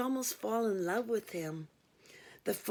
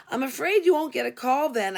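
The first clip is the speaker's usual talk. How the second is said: louder, tight with restrained anger; through gritted teeth; 4 semitones higher, 7 dB louder than usual. A narrator's reading. Operator refuses the lewd caller, restrained anger through gritted teeth. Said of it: "I said through gritted teeth".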